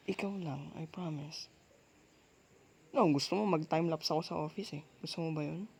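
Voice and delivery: ordinary delivery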